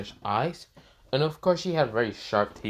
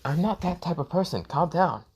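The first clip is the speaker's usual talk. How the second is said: put-on high girl voice; 7 semitones higher than usual. A narrator's reading. Girl pleading with Naruto.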